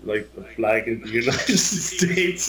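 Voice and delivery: slow, hesitant mock answer with a laugh; imitating the Southern guys' slow reply; mock puzzled reply. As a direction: voice the Southern guys, lightly, as if stating the obvious. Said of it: "They were like".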